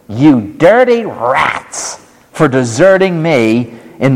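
high, slow, hammed-up rebuke; mock scolding. Imagined Jesus rebuking deserters, hammed-up high scolding. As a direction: scold them like a cartoon villain.

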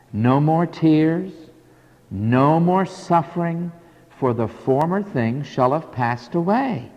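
hushed, measured preaching with long pauses; solemn scripture recital. Acted. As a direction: let each promise settle quietly before the next.

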